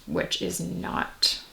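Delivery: hushed whisper to herself; muttered whisper as she tries to recall a film studio; whispered aside. Real, not acted. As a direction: drop to a whisper while trying to remember.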